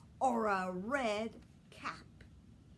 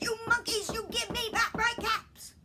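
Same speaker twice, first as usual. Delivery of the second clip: much higher, much louder full-voiced yell; furious shout; 13 semitones higher, 10 dB louder than usual. Peddler shouting his loudest at the stubborn monkeys. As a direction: stamp both feet and bellow it. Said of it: "and he shouted".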